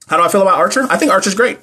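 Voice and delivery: ordinary talk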